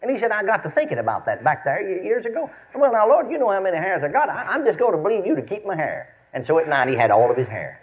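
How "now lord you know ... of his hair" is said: ordinary talk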